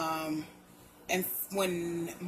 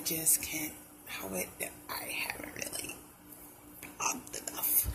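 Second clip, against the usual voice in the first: whispering; 4 semitones lower, 11 dB quieter than usual. Real, not acted. Trailing off breathless.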